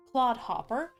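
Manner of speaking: usual speaking voice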